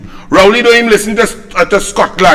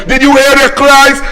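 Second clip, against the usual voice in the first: ordinary talk, nothing marked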